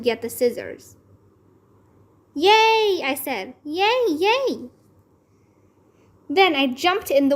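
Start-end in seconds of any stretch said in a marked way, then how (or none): 2.3-4.7 high happy yay; cheering. A narrator's reading. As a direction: cheer with delight. Child cheering in a story.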